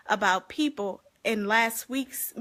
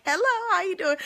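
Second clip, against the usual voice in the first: friendly greeting; 10 semitones higher, 5 dB louder, faster than usual. Retold or imitated. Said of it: "which is what I say".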